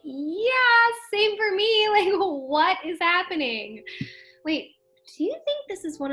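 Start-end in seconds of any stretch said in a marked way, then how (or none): 0.0-3.8 incredulous agreement. Acted. Date agrees, baffled by the situation.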